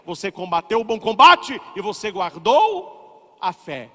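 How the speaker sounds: loud and slowed declaration; fervent preaching. Acted. Priest telling the faithful they kept the faith, loud proclaiming. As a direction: proclaim it like a victory.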